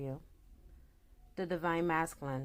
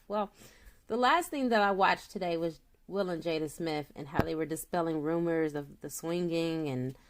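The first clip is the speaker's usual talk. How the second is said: ordinary talk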